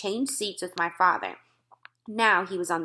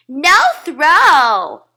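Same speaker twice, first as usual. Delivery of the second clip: put-on high, much louder child's protest; loud toddler shout; 8 semitones higher, 13 dB louder than usual. A narrator's reading. Fudge shouts back at his father in the movies. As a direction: yell it like a stubborn toddler. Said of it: "Fudge said very loud".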